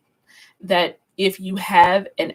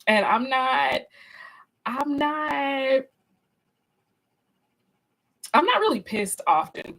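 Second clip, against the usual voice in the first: ordinary delivery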